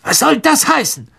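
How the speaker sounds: high, angry demand; fauchend. A narrator's reading. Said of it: "fauchte Mister Malfoy". (gereizt angefaucht)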